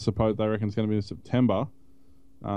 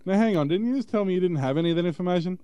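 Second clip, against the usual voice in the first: fast, higher indignant protest; indignant caller; 6 semitones higher, faster than usual. Retold or imitated. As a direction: push back in disbelief. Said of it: "You're like".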